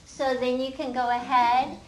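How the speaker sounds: ordinary delivery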